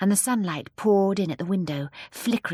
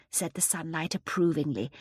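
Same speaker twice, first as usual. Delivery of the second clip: ordinary delivery, nothing marked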